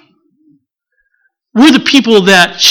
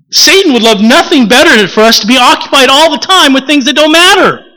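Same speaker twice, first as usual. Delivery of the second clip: usual speaking voice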